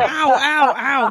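giggling fake pain cries; laughing mock ow. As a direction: whimper ow through laughter.

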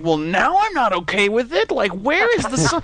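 rising hammed-up alarm; half-hearted mock panic. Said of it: "I'm like".

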